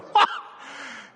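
booming mocking laugh; roaring laugh. A narrator's reading. Strongman laughing at the dwarf, a booming roar. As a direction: laugh him to scorn. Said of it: "drowned her out in a roar of laughter".

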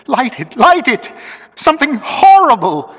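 high plea building to loud panic; beg, climbing into panic; rising terror. A narrator's reading. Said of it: "I could hear his teeth chattering".

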